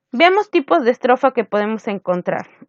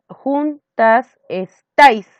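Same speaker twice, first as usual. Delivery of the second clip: juntas estáis slow and louder; stressed syllable; 3 semitones higher, 4 dB louder, much slower than usual. Shown as a demonstration. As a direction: lean on the accent in estáis. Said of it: "ahí está en la cuarta". (lento, acento marcado en estáis)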